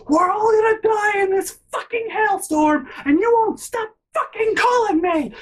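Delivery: very high, loud imitated tirade; mock screaming. Sister screaming at caller during hailstorm, hammed-up shrieking. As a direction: shriek it like someone at breaking point. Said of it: "fucking screaming at this guy".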